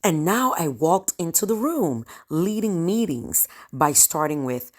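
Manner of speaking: ordinary talk, nothing marked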